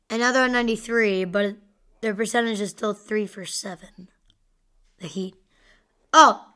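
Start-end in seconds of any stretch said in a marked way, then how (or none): 3.3-5.3 whisper. Real, not acted. Puzzled by the score update. 6.1-6.4 sudden loud oh of discovery; surprised gasp. Real, not acted. Score suddenly updates.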